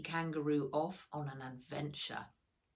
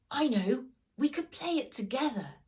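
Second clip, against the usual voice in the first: eager idea; 8 semitones higher, 4 dB louder than usual. A narrator's reading. Boy proposes a plan.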